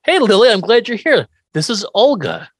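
loud, bright, overeager hello; cheery greeting. Retold or imitated. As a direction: greet her like nothing's wrong. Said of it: "Herman's like".

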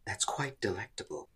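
lightly imitated character, softly spoken; Faust's voice. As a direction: softly mimic another's voice, a light touch. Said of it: "In their voice".

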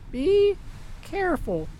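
put-on high, soft old woman plea; tender old woman. A narrator's reading. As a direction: say goodbye softly. Old wife parting words.

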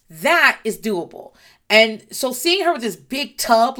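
ordinary talk

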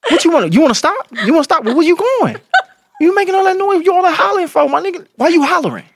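high-pitched comic protest; mock bedroom scolding. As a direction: act out an indignant lover, voice pushed way up. Resumes bedroom act, scolding partner.

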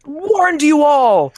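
hammed-up wailing shout, pitched high; mock wail. Co-host mock-wailing at the listeners who voted, hammed-up cry. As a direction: wail it like a doomed prophet.

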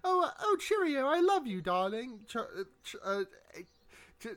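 put-on high, fluttery old lady voice; doddering old lady. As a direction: play a dithering old lady. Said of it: "this doddering old lady type".

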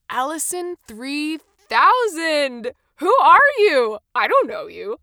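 loud, drawn-out, high-pitched cry of excitement; excited shout-out. Pretend. Podcaster hailing an unknown reviewer, excited shout-out. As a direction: exclaim it big, stretching the words.

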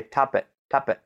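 lightly imitated toddler saying top it without the S; child without front teeth. Mimicking children who lost front teeth, dropping the S. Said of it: "instead they say".

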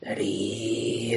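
quiet vampire squeak. Retold or imitated. Dio answering the sailor from inside the coffin, faint low squeak. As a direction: make it a faint creature squeak. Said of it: "I thought I heard something".

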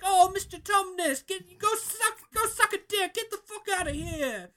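exaggerated British parody insult; mock British accent. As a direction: sneer at Mister Tumnus in a silly British voice. Mocking the show's British dialogue.

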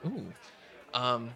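ordinary delivery

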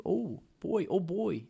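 hammed-up BC "oh, boy"; BC accent. Shown as a demonstration. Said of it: "like that, like that".

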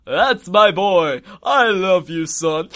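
loud, slow, proud villain dad; hammed-up fatherly pride; Dracula. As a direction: gush loudly over your son, drawing it out. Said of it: "then Dracula's like".

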